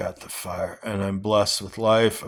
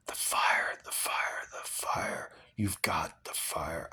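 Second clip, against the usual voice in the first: whisper; 5 semitones lower, 11 dB quieter than usual. Real, not acted. Coaching himself with the book.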